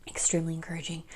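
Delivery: soft whispered echo of the phrase; hushed whispered repeat. Real, not acted. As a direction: breathe the words as a quiet afterthought.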